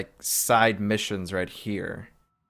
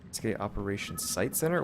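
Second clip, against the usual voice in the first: normal talking voice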